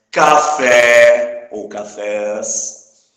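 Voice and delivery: CAFÉ and CAFÉS loud, with a very long final é; teacher belting out the stressed end of café and cafés. Shown as a demonstration. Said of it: "a gente acentua a palavra". (café ou cafés bem alto, esticando o é final)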